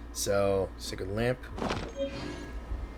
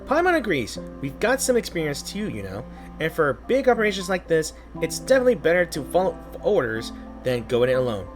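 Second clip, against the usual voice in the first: bright squeaky sidekick, much higher; high excitable companion voice; 3 semitones higher than usual. Acted. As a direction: chirp it up like a tiny mascot. Streamer voicing the excitable companion.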